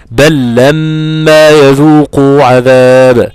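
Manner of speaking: بل لما with a doubled lam, slow and loud; merged lam. Shown as a demonstration. Teacher recites the example of lam merging into lam. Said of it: "لما تيجي اللام في اللام".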